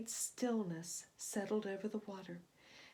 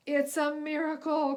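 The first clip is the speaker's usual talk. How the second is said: higher, louder relieved captain; joyful cry; 5 semitones higher, 8 dB louder than usual. A narrator's reading. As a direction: shout with relief after the storm. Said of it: "cried the captain".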